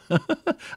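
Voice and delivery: high-pitched giggle at himself; preacher laughing at his own nice-guy joke, high giggle; high chuckle. Real, not acted.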